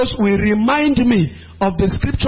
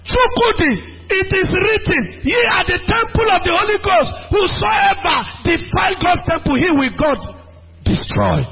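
high, shouted command through laughter; bold scripture rebuke; 8 semitones higher than usual. Shown as a demonstration. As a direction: rebuke him boldly with the verse. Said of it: "Quote the Bible to him".

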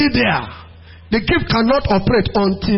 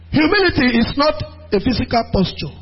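ordinary talk, nothing marked

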